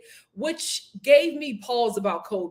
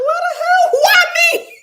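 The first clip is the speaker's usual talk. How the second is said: high, loud "what the hell, why me"; shocked outburst; 11 dB louder than usual. Retold or imitated. Imitating Madeline reacting to Nate: loud shocked disbelief. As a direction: blurt it out, stunned and indignant. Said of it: "She was like".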